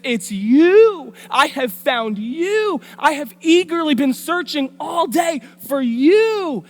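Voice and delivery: high, loud, gushing seductress; mock emotional appeal. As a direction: overblown declaration with long, soaring "you". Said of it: "there's an emotional appeal".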